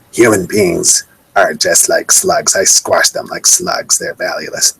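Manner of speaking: slow, eerie goblin voice, fading into a rasp; a goblin gloating at the feast, drawn out and cold; menacing goblin. Retold or imitated. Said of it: "That's what a goblin would say".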